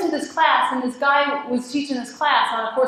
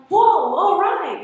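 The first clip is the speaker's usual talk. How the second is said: surprised whoa; 6 semitones higher, 3 dB louder, much slower than usual. Retold or imitated. Surprised by free ticket.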